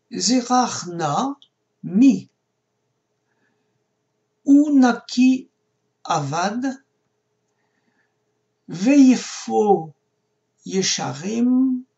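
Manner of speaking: slow Hebrew reading, word by word; careful verse reading. Shown as a demonstration. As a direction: read it out deliberately, pausing after each word or two. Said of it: "on va le lire". (lecture lente et détachée, mot par mot)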